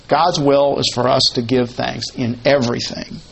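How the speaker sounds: ordinary talk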